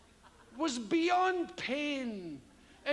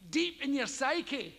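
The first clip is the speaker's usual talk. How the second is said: ordinary talk, nothing marked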